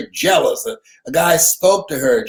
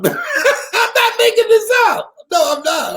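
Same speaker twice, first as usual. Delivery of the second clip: laughing insistence. Real, not acted. Laughing at his own joke.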